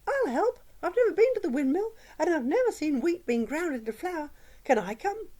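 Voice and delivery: a tiny mouse bursting to join in, high; high cheerful squeaky mouse asking to tag along; cheerful little voice. Acted. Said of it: "a cheerful little voice".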